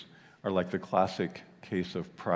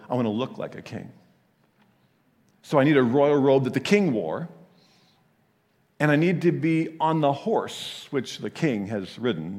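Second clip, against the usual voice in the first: loud and self-important, higher; pompous demand; 4 semitones higher, 5 dB louder than usual. Acted. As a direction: demand royal honors boldly. Haman voiced demanding honors.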